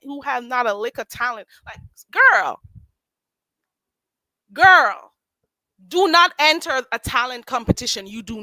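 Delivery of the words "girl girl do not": loud scolding. Real, not acted. Scolding contestant.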